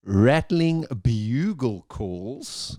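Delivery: puzzled, dragged-out echo; very slow drawn-out repeat. Real, not acted. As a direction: stretch every word out slowly. Repeats the bird description, baffled.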